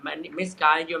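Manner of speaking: usual speaking voice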